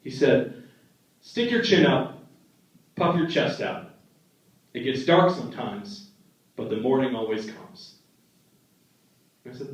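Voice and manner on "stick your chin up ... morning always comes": gentle grandpa wisdom. Retold or imitated. Grandpa's wise quote, softening.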